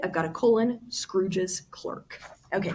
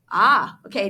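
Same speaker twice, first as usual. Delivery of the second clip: ordinary talk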